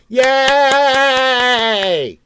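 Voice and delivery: long, high, loud "yay"; mock cheering. Spectators whooping for marathon runners, mock cheering.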